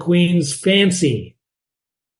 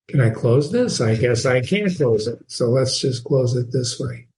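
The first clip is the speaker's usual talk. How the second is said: normal talking voice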